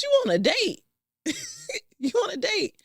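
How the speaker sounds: cracking up mid-line; laughing disbelief. Real, not acted. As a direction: laugh through the words. Laughing at herself.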